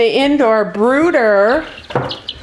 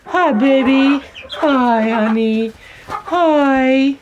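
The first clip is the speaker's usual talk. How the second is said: pet voice; 4 semitones higher, much slower than usual. Real, not acted. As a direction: greet a beloved pet tenderly. Greeting a pet animal.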